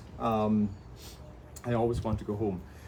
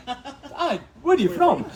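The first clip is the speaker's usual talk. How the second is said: very high, loud retold demand; exasperated insistence; 13 semitones higher, 9 dB louder, slower than usual. Kayaker narrowing down a stranger's home, comically insistent. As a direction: hammer the question high for comic effect. Retold questioning reaches its peak.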